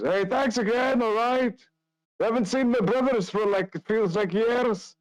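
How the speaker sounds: very high-pitched kitchen voice calling out; Erwin. Acted. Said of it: "Erwin inside the kitchen just goes".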